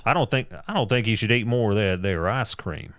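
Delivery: put-on Southern accent; Southern guy. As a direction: do a Southern guy giving advice. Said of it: "or a Southern guy".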